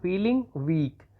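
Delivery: "feeling weak" drawn out very slowly; very slow dictation of "feeling weak". Shown as a demonstration. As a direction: stretch "feeling weak" so students can write it. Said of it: "तो आप लिख देंगे feeling".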